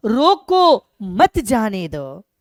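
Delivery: loud, drawn-out रोको, a break, then मत जाने दो together; pause after रोको. Shown as a demonstration. Teacher showing how a comma changes meaning, pause after रोको. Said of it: "केवल यहाँ पर हमने कॉमा दिया".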